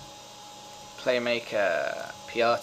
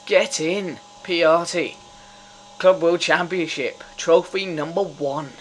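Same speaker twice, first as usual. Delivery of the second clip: loud, raised victory call; real jubilant cheer; triumphant celebration; 5 semitones higher, 7 dB louder than usual. Real, not acted. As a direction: announce the win with glee. Celebrates the trophy.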